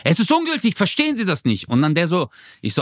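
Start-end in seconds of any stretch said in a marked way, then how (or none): 0.1-1.7 high, exasperated imitation of the clerk; retold angry outburst, high but not truly shouted; mock freak-out. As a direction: act out him losing it, pitch jumping up. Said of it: "dann flippt er voll aus".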